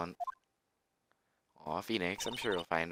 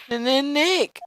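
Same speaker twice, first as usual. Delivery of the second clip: loud, much higher stammering cry; high excited girl; 6 dB louder, slower than usual. Acted. Maya calls Nick on the phone, high panic. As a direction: stammer out his name in a panic.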